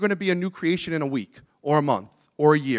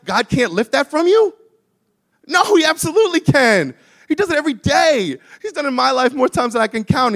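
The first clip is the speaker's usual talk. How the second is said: raised, driving sermon voice; impassioned preaching; 7 semitones higher, 5 dB louder than usual. Real, not acted. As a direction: preach it with rising conviction. Preaches at full force.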